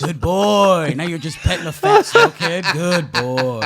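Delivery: put-on loud, laughing good boy; teacher loudly petting the tough kid; mock praise. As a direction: praise him like a pet, loudly.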